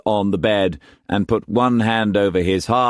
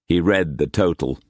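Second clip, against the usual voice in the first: plain reading voice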